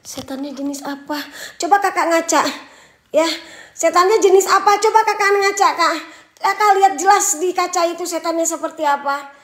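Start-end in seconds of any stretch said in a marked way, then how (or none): 1.6-9.3 high, loud comeback; loud sarcastic retort. Real, not acted. Streamer telling a viewer to check a mirror, loud retort. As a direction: fire the question back, raised and loud. Throws viewer's question back louder.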